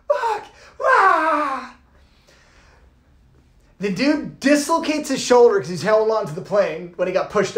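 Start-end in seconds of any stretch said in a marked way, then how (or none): 0.1-1.8 high startled curse and yell; shocked cry. Retold or imitated. Watching the coach push him out, shocked cry. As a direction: blurt it out in shock.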